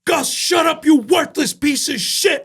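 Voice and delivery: mimicking the boss shouting him down; chef's yell. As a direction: bark it like a tyrant chef in the kitchen. Chef screaming at him again.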